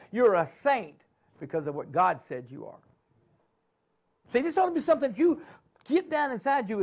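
rising, pressing preacher cadence; preaching. Acted. Preacher rallying his congregation, in full sermon cadence.

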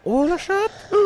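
very high, startled exclamation; surprised shriek. Real, not acted. Gamer shrieking at a lucky drop, high and surprised. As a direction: squeal at sudden luck.

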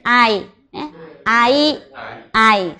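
"Ai" run together quickly as one word; read straight through. Shown as a demonstration. Teacher showing the class the joined reading, run together. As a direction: blend A and I into a single "Ai". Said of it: "Mình đọc luôn nhanh".

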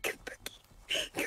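slow hushed whisper; eerie slow whispered call; creepy whisper. Pretend. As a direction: beckon softly in the dark. Whispered chase, then repeated plain.

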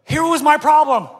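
high, loud comic complaint; exclaimed, high and loud. Real, not acted. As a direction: pitch it up and push it out. Preacher playfully owning his football trouble.

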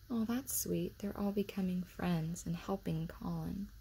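unvoiced, soft aside whisper. Real, not acted. Reader whispering a tender aside to the listener. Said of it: "aside to listener".

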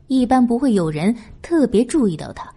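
plain reading voice

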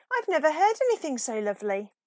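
fast, high and loud gushing; delighted. Acted. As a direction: rush out the praise. Peep awed after the song.

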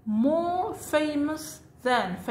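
drawn out so the class can copy it; teacher spelling it out slowly; slow model reading. Shown as a demonstration. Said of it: "قبل الصفة نضع more".

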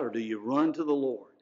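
usual speaking voice

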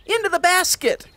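high and eager; urgent. Acted. The cow rushing her friends into the basket, high and eager. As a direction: hurry everyone aboard. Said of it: "Clorinda said".

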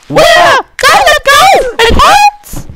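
very high, loud squealed yelling; screamed outburst. Real, not acted. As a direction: scream through the jump in a squeal. Yelling during big jump.

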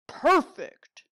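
loud, high delighted "Perfect"; gleeful bear. Acted. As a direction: relish the naughty plan. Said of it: "Bear said".

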